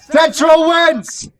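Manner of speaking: high, very slow victory call; excited announcement. Real, not acted. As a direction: stretch out the winner's name. Announcing the winner.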